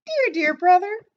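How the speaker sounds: put-on very high, loud child's voice; little girl voice. A narrator's reading. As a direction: squeak it like a small girl. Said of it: "I don't like that voice".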